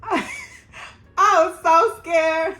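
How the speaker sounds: high, loud, slow, laughing fear; laughing scared. Real, not acted. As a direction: relive the terror through nervous laughter. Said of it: "I feel like I'm there now".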